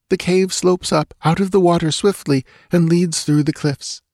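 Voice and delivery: high, anxious female character voice; frightened dancing girl. A narrator's reading. Captive girl telling Conan the secret way, anxious. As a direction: explain earnestly in a high girlish voice.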